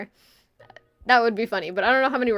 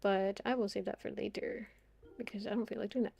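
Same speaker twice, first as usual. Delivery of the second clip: ordinary talk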